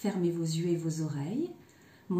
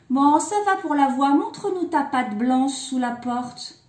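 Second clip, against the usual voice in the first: loud, higher, emphatic demand; challenging eldest kid; 5 semitones higher, 7 dB louder than usual. A narrator's reading. Eldest kid testing the wolf, loud challenge. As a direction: demand proof loudly from behind the door.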